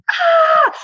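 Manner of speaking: a short, very high, loud excited "Ah!"; mock excited yell. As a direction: shriek it like a starstruck fan. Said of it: "yelling like this".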